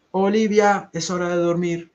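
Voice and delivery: put-on high, loud call to a child; mother calling. A narrator's reading. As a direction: call the child to bed from afar. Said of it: "escucha la voz de su mamá".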